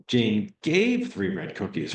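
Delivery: "gave" stretched and accented, falling after it; stress on gave. Shown as a demonstration. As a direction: lean hard on "gave", drawn out. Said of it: "You're, you're emphasizing".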